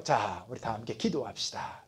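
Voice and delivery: hushed call to prayer; mock prayer leader. Pastor mimics himself calling the church to pray, hushed. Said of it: "다 기도하면서 기도하는데".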